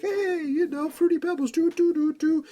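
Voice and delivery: mock the cheery goofy Cena, sing-song; happy-go-lucky Cena. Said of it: "happy-go-lucky Cena with the happy jolly face".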